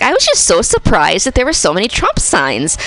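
thick Midwestern mom voice; Midwest accent. Retold or imitated. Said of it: "I do this very thick Midwest accent".

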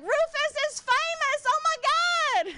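very high, drawn-out squeal; excited nieces. Retold or imitated. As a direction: imitate overexcited little nieces squealing. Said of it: "My nieces get so excited".

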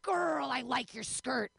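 lightly voiced leering catcaller. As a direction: play a man hollering at a woman. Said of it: "A gentleman said".

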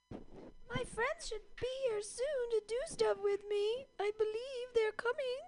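very high, soft little cat voice. Pretend. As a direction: squeak it out like a pet cat. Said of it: "that's like a cat voice I do".

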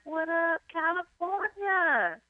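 high, slow hype-man call; mock MC shout-out. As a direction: play to the crowd like a stage host. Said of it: "I'd be like".

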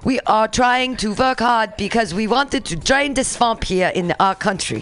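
deliberate foreign president in a fake Russian accent; bad Russian accent. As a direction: flatter the other leader in a faked Russian accent. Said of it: "how do I sound like a Russian".

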